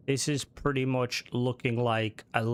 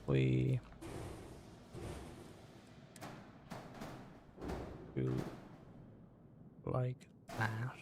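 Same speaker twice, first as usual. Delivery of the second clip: quiet mumble under the breath; mumbling while busy building; 5 semitones lower, 13 dB quieter, slower than usual. Real, not acted. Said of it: "I'm, like, mumbling".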